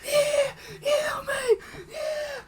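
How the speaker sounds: very high falsetto squeals; mock burning-man yelps. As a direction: squeal like a cartoon man on fire. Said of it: "the guy on fire is like".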